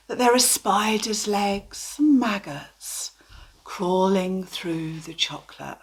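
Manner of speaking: hushed, breathy-soft gentle delivery; soft therapy voice. Shown as a demonstration. Therapist showing the bland therapy voice, soft. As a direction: soothe instead of disgust. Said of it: "if you just do it in your therapy voice".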